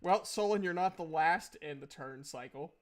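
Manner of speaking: ordinary talk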